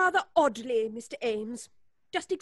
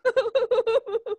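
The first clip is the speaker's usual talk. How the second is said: a short, high, uneasy laugh; nervous laugh; 6 semitones higher, 6 dB louder than usual. Acted. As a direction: laugh off a strange question nervously. Nervous laugh at his question.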